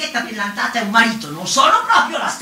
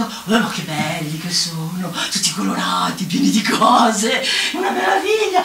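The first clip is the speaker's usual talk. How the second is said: wonder; 3 semitones lower than usual. Acted. Marvels at supermarket goods.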